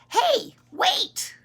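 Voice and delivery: put-on high, loud child's call; little boy calling. Boy chasing the old man, urgent call. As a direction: call after someone in a high voice. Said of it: "And he says".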